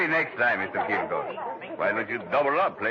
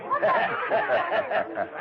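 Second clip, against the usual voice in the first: big high-pitched belly laugh; hearty high laugh. Acted. Club owner laughing at his rich customer, big high cackle. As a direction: laugh it up at the rich man's expense. Gambler host laughs at Kilgore.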